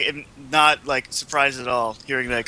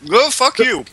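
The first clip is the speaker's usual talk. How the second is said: playful retort; 8 semitones higher, 5 dB louder, slower than usual. Real, not acted. Retort to teasing.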